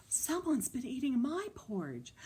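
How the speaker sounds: lightly put-on mother bear; Mama Bear voice. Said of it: "Mama Bear said".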